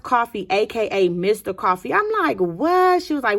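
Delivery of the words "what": loud, high cry of disbelief; shocked. Acted. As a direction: blurt it out in shock. Friend hears surprising news.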